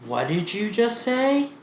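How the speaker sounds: slow and careful, rising high at the end; slow, puzzled question. Retold or imitated. Thai kids to English teacher, slow puzzled question. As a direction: ask it word by word, baffled.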